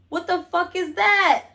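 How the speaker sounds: high, slow stunned what-the-fuck; boyfriend's mock-shocked reaction; shocked outburst.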